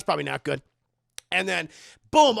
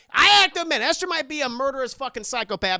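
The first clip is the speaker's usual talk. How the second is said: ordinary delivery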